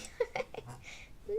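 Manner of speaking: quick breathy giggle; a light amused chuckle; short airy laugh; soft laugh. Real, not acted. Laughs at Uber Eats ad.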